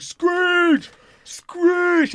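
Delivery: high mock heckler yell. Heckler yelling at the comic from the back, high mock yell.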